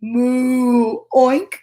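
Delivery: long moo, short rising oink; cow and pig calls. Acted. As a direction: moo slowly, then pop an oink. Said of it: "could moo and oink".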